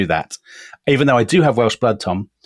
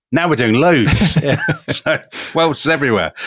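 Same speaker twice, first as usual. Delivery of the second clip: laughing; 4 semitones higher than usual. Real, not acted. Laughs about too much Welsh.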